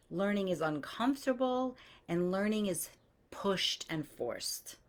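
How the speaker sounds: ordinary delivery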